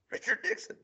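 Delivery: squeaky high mock Nixon; high squeak. Punchline voice for Nixon.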